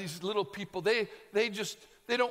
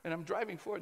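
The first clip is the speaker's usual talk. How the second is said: ordinary delivery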